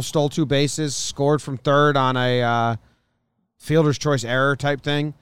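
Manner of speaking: ordinary talk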